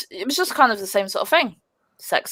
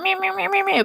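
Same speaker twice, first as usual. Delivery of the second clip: even higher mocking mew; mewing back; 14 semitones higher, faster than usual. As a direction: throw the whine straight back, pitched way up. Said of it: "So I was going ... back".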